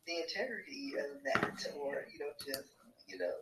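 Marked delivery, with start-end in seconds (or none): none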